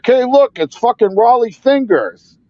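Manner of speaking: high-pitched imitation of jeering strangers; mocking heckler. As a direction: poke fun like a subway heckler. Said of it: "making fun of my dad".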